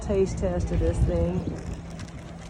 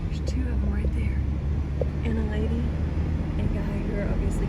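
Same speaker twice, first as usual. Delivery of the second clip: whispering; 3 semitones higher, 3 dB quieter than usual. Real, not acted. Whispering near wild elk.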